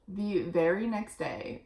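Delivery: ordinary delivery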